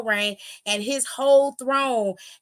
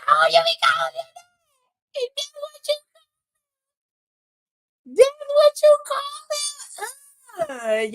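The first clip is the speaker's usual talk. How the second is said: scandalized glee; 12 semitones higher than usual. Retold or imitated. Host reacting to a secret pet name, scandalized. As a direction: squeal it high, delighted by the scandal.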